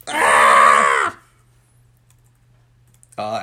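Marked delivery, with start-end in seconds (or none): 0.1-1.2 loud, lower-pitched held scream; mock scream. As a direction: let out a long hammed-up scream. Acts out the teacher's scream.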